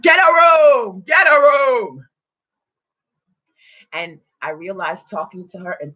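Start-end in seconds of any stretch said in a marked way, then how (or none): 0.0-2.0 high, loud jeering call, slowed on the first line; mocking hater shout. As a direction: yell it at the couple like a rude stranger. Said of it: "the hater in the restaurant that was like".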